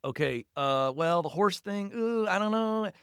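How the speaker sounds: hesitant, rising into a high uneasy hedge; stall, then wince upward in doubt; doubtful parent. Acted. Parent reacting to a child's horse idea, acted hesitant doubt.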